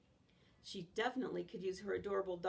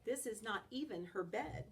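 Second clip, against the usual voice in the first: plain reading voice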